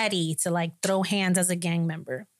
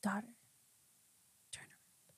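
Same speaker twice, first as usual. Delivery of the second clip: unvoiced, soft divine whisper; 3 semitones higher, 16 dB quieter than usual. The Holy Spirit calling her back, hushed whisper. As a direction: breathe it like a still small voice. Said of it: "a whisper".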